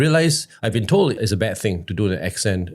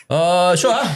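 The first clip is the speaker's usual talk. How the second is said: higher-pitched doubtful caller checking; hesitant question in a raised voice; wary caller; 7 semitones higher, 4 dB louder than usual. Retold or imitated. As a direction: mimic a caller unsure he is forgiven. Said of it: "so you're not".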